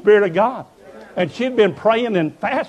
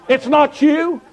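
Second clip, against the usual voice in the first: loud, high preacher's cry; fiery revival preaching; preaching at full force; 8 semitones higher, 5 dB louder, slower than usual. Acted. Preaching climax.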